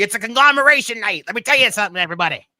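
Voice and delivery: wrestler promo voice. Retold or imitated. Friend hyping the group up in a loud wrestler promo voice. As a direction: shout it like a wrestler cutting a promo.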